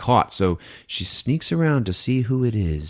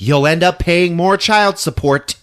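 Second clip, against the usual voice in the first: snide warning; 9 semitones higher, 7 dB louder, slower than usual. Retold or imitated. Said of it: "Rosemary stops him and is like".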